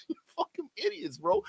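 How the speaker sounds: high, laughing scornful jab; laughing insult. Real, not acted. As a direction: laugh it out at them.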